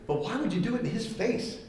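ordinary talk, nothing marked

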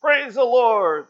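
high, loud, slow cry of praise; lady in a shouting match. Acted. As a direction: shout her side of the battle. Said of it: "a shouting battle".

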